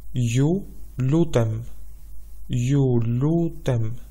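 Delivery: Ju lutem said slowly, each syllable drawn out; slow teaching demo. Shown as a demonstration. Teacher spelling out Ju lutem slowly for listeners. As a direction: stretch out Ju lutem for learners to copy. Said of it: "The word please".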